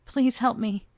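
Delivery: loud begging in pain; pleading cry. Acted. Luca in pain begs Soren, loud pleading cry. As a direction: cry out for help. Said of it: "He was sobbing in pain".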